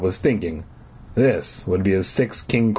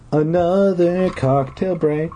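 slow, higher silly voice; silly aside voice; 6 semitones higher, slower than usual. Acted. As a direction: toss it off in a goofy voice.

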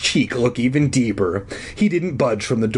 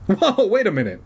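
interrupting; 8 semitones higher than usual. A narrator's reading. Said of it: "I interrupted".

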